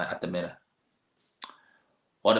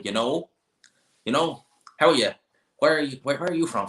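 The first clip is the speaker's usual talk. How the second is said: put-on West Coast Irish accent; 5 semitones higher than usual. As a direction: mimic a West Coast Irish local quizzing a stranger. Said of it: "I struggle to understand some of those West Coast accents".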